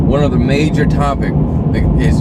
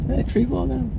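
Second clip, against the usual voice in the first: surprised; 13 semitones higher, 8 dB quieter than usual. Real, not acted. Sudden aside while walking outside.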